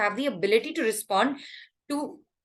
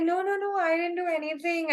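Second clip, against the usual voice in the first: high, fake-innocent protest; mock innocent denial; 8 semitones higher than usual. Imitating a troublesome relative denying everything, high mock innocence. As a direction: imitate someone playing innocent. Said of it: "they act all like".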